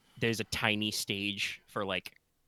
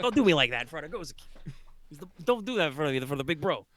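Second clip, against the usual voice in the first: embarrassed son; 5 semitones higher, much faster than usual. Acted. Son complaining to his mother.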